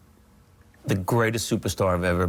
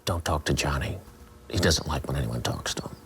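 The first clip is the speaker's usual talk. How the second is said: low hushed warning; 8 semitones lower, 5 dB quieter than usual. Retold or imitated. Talent coordinator's warning, retold.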